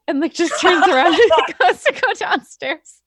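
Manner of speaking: laughing. Real, not acted. Laughing while narrating.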